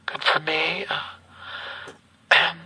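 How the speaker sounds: tinny recorded phone message; like a voicemail played back; answering machine message. Real, not acted. Playing the woman's voicemail.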